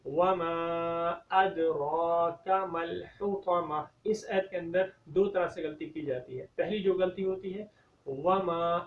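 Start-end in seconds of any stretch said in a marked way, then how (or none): 0.1-3.8 slow drawn-out recitation; Quran recitation. Shown as a demonstration. Teacher recites the next verse for the class, slow chant. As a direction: chant it slowly.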